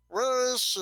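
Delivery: loud, high-pitched searching call; high, urgent "where is she" call. Acted. Nurx looks around, calling out.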